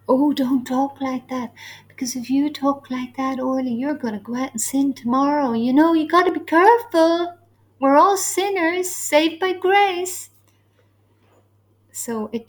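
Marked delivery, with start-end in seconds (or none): 0.1-10.3 higher, hammed-up voice of cautioning believers; mocking worried believers. Teacher imitating Christians who warn her about sin, mock-worried. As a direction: parody their warning, pitch climbing and louder toward the end. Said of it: "this is the thinking".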